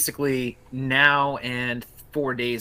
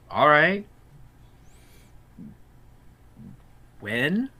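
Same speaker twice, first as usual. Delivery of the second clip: puzzled, rising question; hesitant, questioning upturn on the ends; confused; 3 semitones higher, 5 dB louder, much slower than usual. Acted. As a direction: draw it out slowly, tilting up in confusion. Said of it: "look at them confused".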